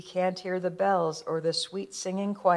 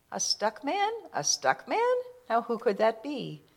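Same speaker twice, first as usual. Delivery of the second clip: puzzled wonder; 4 semitones higher than usual. Acted. Stickman puzzled at the voice.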